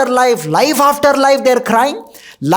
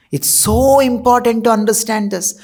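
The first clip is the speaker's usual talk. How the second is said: ordinary delivery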